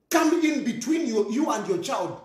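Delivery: ordinary talk, nothing marked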